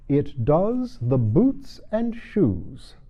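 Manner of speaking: very slow, solemn pronouncement. Acted. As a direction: announce it gravely, slowly. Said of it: "the Gryphon replied very solemnly".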